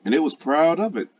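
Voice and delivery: usual speaking voice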